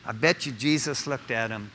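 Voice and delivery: usual speaking voice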